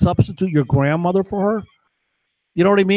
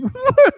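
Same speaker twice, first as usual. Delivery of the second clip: mock sob; 4 dB louder than usual. Mocking the woman's sob.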